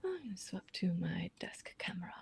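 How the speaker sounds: whispered aside, barely voiced; whispering. Real, not acted. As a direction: whisper it to yourself. Aside while switching camera.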